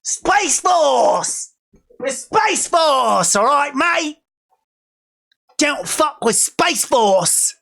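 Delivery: high, slow mock Aussie bravado; broad Australian accent, vowels stretched out. Aussie space force warning off rivals, high drawn-out Australian accent. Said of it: "My, my Australian accent".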